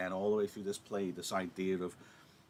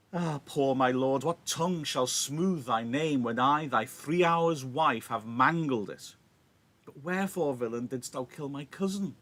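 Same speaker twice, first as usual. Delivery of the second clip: louder impassioned recital; dramatic Shakespeare reading; 3 semitones higher, 5 dB louder than usual. Acted. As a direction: read Juliet's defence with feeling. Teacher reads Juliet's speech.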